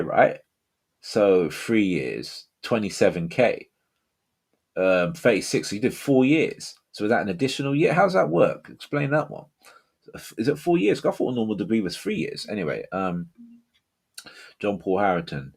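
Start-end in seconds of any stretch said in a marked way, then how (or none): 5.7-12.5 puzzled. Real, not acted. Puzzling over the debt.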